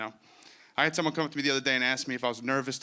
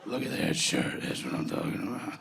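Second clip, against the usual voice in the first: much lower, breathy leering creep; sleazy creep voice; 9 semitones lower, much slower than usual. Retold or imitated. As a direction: leer like a sleazy pickup guy. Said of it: "They hear".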